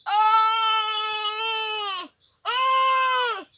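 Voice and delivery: high, loud cries of pain; loud pained groan. Acted. Crocodile wakes the forest with a loud groan of pain. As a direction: howl out in agony. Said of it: "by a loud groan".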